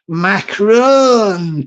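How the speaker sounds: drawn-out French pronunciation of Macron; French accent. Pretend. Trying out the French president's name, French accent. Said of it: "parlez-vous français accent".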